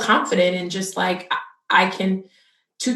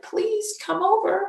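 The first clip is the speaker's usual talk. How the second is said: much higher, begging come over; pleading invitation; 13 semitones higher than usual. Retold or imitated. New mom begging her friend to visit, high pleading. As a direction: plead with your friend, half joking. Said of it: "I was like".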